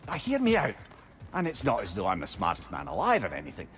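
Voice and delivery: ordinary delivery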